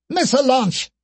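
high shrieked alarm. Acted. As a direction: shriek the warning.

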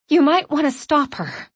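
gasping for breath, loud; panting out of breath. Acted. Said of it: "gasping for breath".

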